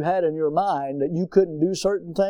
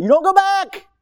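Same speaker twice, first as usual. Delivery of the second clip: high, loud preaching stress; fervent preacher shout; preached emphasis; 13 semitones higher, 6 dB louder than usual. Acted. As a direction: drive it home to the congregation. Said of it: "preaching emphasis".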